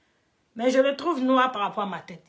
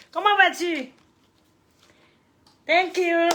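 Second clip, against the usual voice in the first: cheery greeting; 6 semitones higher, 4 dB louder, slower than usual. Real, not acted. Greeting a viewer in chat.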